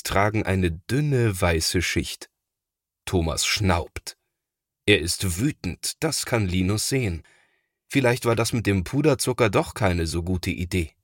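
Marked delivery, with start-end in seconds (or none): none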